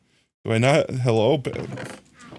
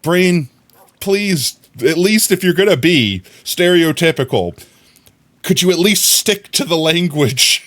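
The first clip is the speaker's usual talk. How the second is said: higher, louder, pleading with own brain; exasperated plea; 6 semitones higher, 4 dB louder than usual. Real, not acted. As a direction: beg your brain to behave, half laughing.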